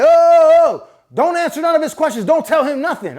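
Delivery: high, loud "oh" cutting in, then urgent orders; mock-panicked, high-pitched cut-in; alarmed interruption. Woman jumping up to silence the nurse, alarmed interruption.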